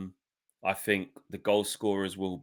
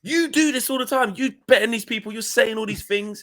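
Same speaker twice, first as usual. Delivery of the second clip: high, louder scolding mimic; hammed-up frustrated rant; mock exasperation; 12 semitones higher, 4 dB louder than usual. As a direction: tell him off, pitched way up. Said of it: "And I'm like".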